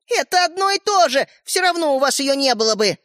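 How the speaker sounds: put-on high, indignant girl; dismissive retort. A narrator's reading. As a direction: brush the protest aside sharply. Sister argues back. (раздражённо)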